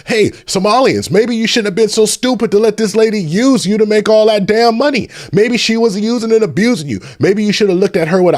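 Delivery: jabbing accusation, voice climbing high; heated rant. Real, not acted. Streamer scolding scammed Somalians, heated high-pitched rant.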